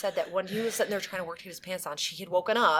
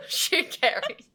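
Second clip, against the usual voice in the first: laughing tease; 10 semitones higher, much slower than usual. Real, not acted. Teasing friend.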